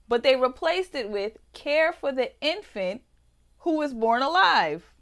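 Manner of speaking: heated outrage. Real, not acted. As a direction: build to an indignant peak. Climactic outraged reading.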